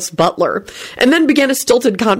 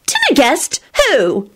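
high, curious burst; curious outburst; 8 semitones higher, slower than usual. A narrator's reading. Rosie hears of a dinner guest, bursting out curiously. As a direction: blurt the question out, pitched up. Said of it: "Rosie burst out curiously".

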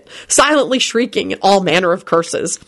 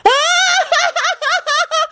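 shrieking laugh. A narrator's reading. Magnified Kenny laughing.

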